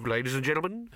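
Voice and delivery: a hint of a grand showman. A narrator's reading. Showman thanks the crowd.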